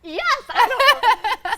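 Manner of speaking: high, loud and slow, overwhelmed; flustered answer. Retold or imitated. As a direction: blurt it out, dazed and overjoyed.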